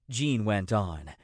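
plain reading voice

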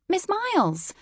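put-on high, bright British-accented cry of recognition; British exclamation. A narrator's reading. British office woman spotting the late guest, British exclamation. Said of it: "she exclaimed in a British accent".

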